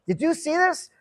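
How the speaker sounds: lightly voiced anxious mom asking; mom's concern. Mom's alarmed message voiced.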